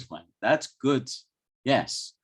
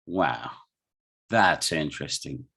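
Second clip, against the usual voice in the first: low-pitched, uninterested irony; ironic; 6 semitones lower than usual. Shown as a demonstration. Teacher showing how prosody changes meaning, ironic. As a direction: say it low, meaning you are not interested at all. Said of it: "I'm not interested. I'm being ironic".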